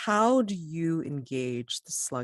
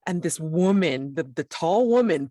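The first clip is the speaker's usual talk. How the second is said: ordinary talk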